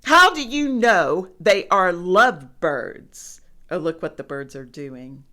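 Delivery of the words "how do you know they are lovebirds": loud, indignant question; exasperated elephant, voice raised; disgusted challenge. A narrator's reading. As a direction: demand an answer loudly.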